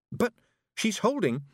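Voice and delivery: high-pitched astonished protest; startled, high and breaking off; amazed. A narrator's reading. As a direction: begin in amazement, voice jumping up. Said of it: "Tiffany began in amazement".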